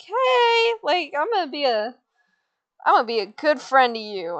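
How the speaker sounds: high, eager friendly dog voice; Perrito. Retold or imitated. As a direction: open high and loud, then ease down. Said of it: "Perrito's like".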